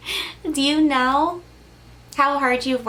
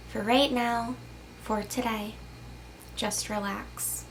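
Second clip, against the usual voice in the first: much softer, slow, fading to near hush; soft soothing; 2 semitones lower, 10 dB quieter than usual. Acted. Calming the viewer at the end, softer and slow. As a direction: lull them into rest.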